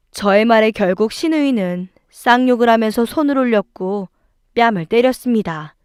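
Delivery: ordinary delivery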